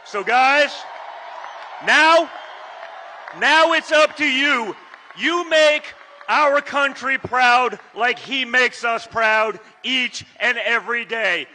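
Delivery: high, loud rally climax; rally shout. Acted. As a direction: lift the crowd to a roar.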